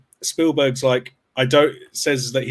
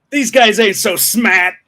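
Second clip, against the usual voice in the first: tough alien taunt; 8 semitones higher, 6 dB louder, slower than usual. Retold or imitated. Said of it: "They were like".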